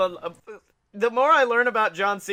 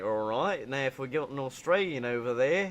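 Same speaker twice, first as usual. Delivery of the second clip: low, soft mock Australian; imitated Australian accent; 5 semitones lower, 5 dB quieter than usual. Retelling John's voice test in an interview, Australian accent. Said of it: "this guy would be Australian".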